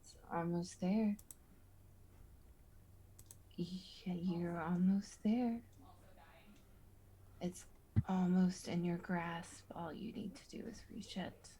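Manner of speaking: hushed seductive voice in your head; tempting whisper. Acted.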